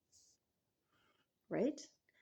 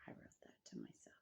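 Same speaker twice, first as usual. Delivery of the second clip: whispered, almost voiceless admission; hushed confession; 19 dB quieter than usual. Real, not acted. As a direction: let it out in a bare whisper. Confessing the letter is hers.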